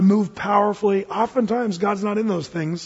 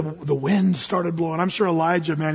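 normal talking voice

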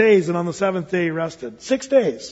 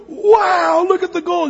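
high-pitched, hammed-up wow; mock amazement; 11 semitones higher, 5 dB louder, slower than usual. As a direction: gush in pretend awe at the sight. Mock gushing over golden streets.

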